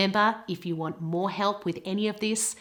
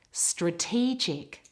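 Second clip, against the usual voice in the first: slow "Strategic", weight on TEE; stress on middle syllable; 2 semitones lower, slower than usual. Shown as a demonstration. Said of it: "It's in the middle of the word".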